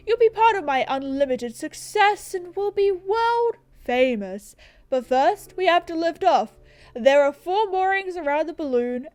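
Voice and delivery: lightly voiced boastful adventurer; grand explorer. Balloonist recruiting help to undo the moorings, grandly. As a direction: proclaim it like an eager expedition leader. Reads explorer's dialogue aloud.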